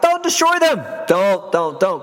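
high-pitched, urgent plea; acted-out desperate appeal; urgent pleading. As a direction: beg them to stop, voice jumping up. Acts Moses pleading with God.

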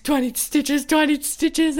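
a worried mock plea; retold panicked question. Dazed asking after a crash.

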